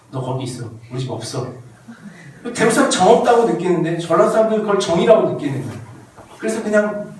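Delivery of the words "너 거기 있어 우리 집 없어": low, soft deadpan brush-off; acted terse refusal, voice dropped low; curt refusal. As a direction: turn the guest away quietly, no fuss. Acted Daegu person's curt refusal.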